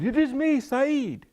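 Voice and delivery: very high, loud farmer voice; silly farmer shouting up high; Saeed calling out. Acted. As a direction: announce yourself in a high, loud cry. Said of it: "And he said".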